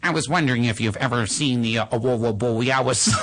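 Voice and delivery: imitating a robotic toddler who can't say R; R's as W's. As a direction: Borealis said "Bowiawis", every R turned into W. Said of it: "He can't pronounce R's".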